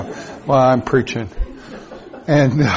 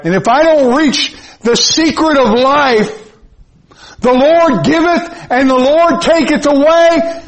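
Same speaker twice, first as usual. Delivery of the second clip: full-force preaching; 11 semitones higher than usual. Acted. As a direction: proclaim it to the rafters.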